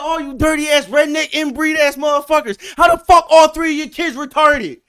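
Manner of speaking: high, loud enraged yelling; hollering at the neighbors across the fence; furious shout. Retold or imitated. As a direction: scream it like you're ready to fight. Neighbor insulting the family.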